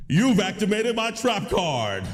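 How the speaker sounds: hammed-up high card-game shout, slow and laughing; Yu-Gi-Oh duelist. Yu-Gi-Oh nerd punishing chatter, hammed-up duelist shout. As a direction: declare the trap card like an anime duelist. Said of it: "I was a Yu-Gi-Oh nerd".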